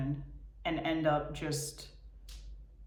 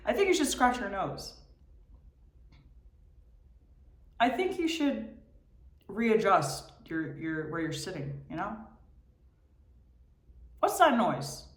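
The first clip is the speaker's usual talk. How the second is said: high, needling brain voice; mocking inner voice; 4 semitones higher than usual. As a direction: pester like a restless mind. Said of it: "mimicking and mocking what's going on in my head".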